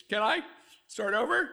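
ordinary talk

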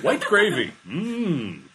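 high, loud product plug, then a savoring mmm; mock ad enthusiasm. Co-host chiming into a fake gravy advert, hammed-up relish.

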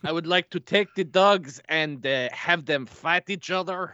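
hammed-up French accent, slowing at the end. Undercover Terry proposing a dog fight, French accent. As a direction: fake French, sounding out each phrase. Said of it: "Where's your French accent?".

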